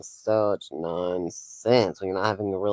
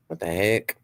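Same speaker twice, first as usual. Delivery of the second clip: ordinary talk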